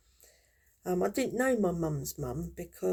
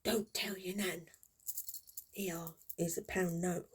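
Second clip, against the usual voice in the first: softer conspiratorial whisper; mock secretive granddad, softer; 5 dB quieter than usual. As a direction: slip it to the kid so nan won't hear. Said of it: "he used to say".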